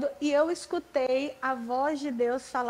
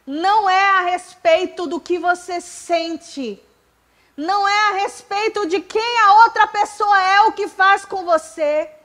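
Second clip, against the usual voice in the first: loud, slow and higher, hammering each phrase; preaching at full force; 7 semitones higher, 11 dB louder, slower than usual. Real, not acted. Preacher driving the point home to the congregation, loud and slow. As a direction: proclaim it to the whole church.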